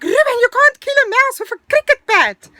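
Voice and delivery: high, loud scolding yell; loud protest. Retold or imitated. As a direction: re-enact a mother exclaiming at her son. Mother voiced protesting at son.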